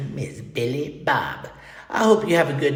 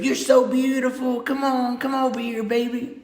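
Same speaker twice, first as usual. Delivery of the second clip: sweet talk; 8 semitones higher than usual. Pretend. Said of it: "that's the kind of stuff".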